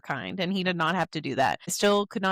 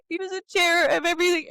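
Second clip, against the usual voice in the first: tearful gushing; 8 semitones higher, faster than usual. Retold or imitated. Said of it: "And I'm like".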